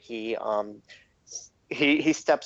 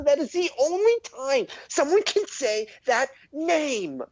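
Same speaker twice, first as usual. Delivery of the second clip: lecturing gnome, emphatic; 10 semitones higher than usual. Acted. Gnome explains a father-to-son rite to an outsider, high and emphatic.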